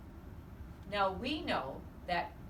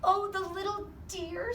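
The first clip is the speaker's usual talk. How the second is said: high cooing delight, much higher; cooing Mrs Watson; 11 semitones higher, 3 dB louder than usual. A narrator's reading. Mrs Watson cooing at the new piglet, high and delighted. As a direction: gush over the little dear.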